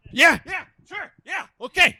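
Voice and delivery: very high, eager little yeses. Acted. Farmer agreeing to take over from the vet, very high and eager. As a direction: jump at the chance in a high voice.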